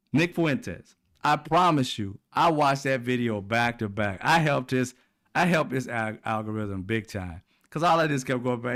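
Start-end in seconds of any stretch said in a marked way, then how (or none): none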